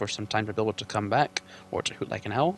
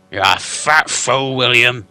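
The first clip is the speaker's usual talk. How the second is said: loud, slow mocking troll; troll Bert insulting; 2 semitones higher, 12 dB louder, slower than usual. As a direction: jeer at William slowly.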